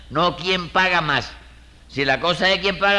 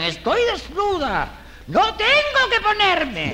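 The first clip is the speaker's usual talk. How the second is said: high, drawn-out falsetto cry; mock wailing wife; slower than usual. Man mocks married women who have nothing to wear, wailing falsetto. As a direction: imitate a wife's exaggerated complaint, slow and high. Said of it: "Y enseguida gritan".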